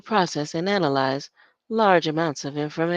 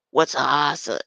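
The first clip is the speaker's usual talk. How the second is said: [name]; 4 semitones lower, 6 dB louder than usual. Retold or imitated. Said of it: "[name] saying".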